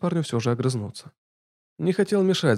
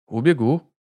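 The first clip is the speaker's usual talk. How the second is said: firm; 3 semitones higher, 6 dB louder, slower than usual. A narrator's reading. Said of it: "решительно не сдавался".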